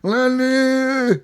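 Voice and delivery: very high, long held holler; mock scream. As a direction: call the name as a drawn-out drunken mock yell. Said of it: "Fucking screaming Lenny at the bar".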